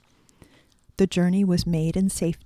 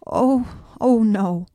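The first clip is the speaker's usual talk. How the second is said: alarmed exclamation; 4 semitones higher, 5 dB louder, slower than usual. A narrator's reading. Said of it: "exclaimed the Tin Woodman".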